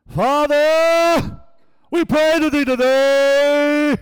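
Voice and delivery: booming, high, very loud prayer voice; God voice. Retold or imitated. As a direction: imitate a preacher thundering a drawn-out prayer. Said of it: "all of a sudden his voice changed".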